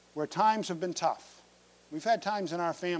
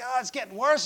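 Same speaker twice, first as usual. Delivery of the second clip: lightly voiced whiny daughter, higher; mock-whine like a kid in pain; whining daughter; 7 semitones higher than usual. Daughter complaining to her parents, a hint of whining. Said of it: "She starts whining and complaining".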